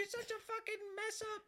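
very high-pitched mock berating; high put-on scolding voice; imitating scolding mom. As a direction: tear into him as a caricature angry mother. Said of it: "and then she's like".

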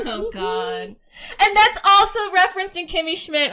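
high, loud burst of laughter while speaking; laughing. Real, not acted. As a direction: talk through a shrieking laugh. Bursting out laughing about the musical.

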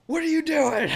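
loud high demand; yelling. Retold or imitated. Cop yelling at a kid on the wall. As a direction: shout it like a cop catching a kid. School cops yelling question.

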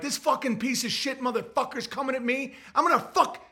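high, tense mock threat; demonstrating an agitated swearing rant. Shown as a demonstration. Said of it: "I go back to how you talking to Swigo".

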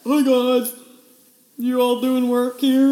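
loud, slow hearty boss greeting; boss voice imitation. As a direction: call out cheerfully to the staff like a cocky boss. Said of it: "he'd be like".

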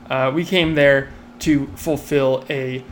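ordinary talk

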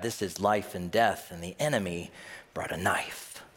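usual speaking voice

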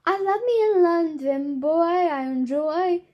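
playful British impression, drawn out; mock British accent. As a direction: put on a hammed-up British voice. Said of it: "If you're British, like, aw".

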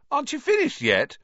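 high-pitched disbelieving question; incredulous call. A narrator's reading. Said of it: "called Ron incredulously".

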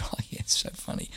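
quick, whispered excuse; meek whisper. Retold or imitated. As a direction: whisper it fast, caught out by the sergeant. Meek reply to drill sergeant.

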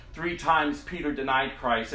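normal talking voice

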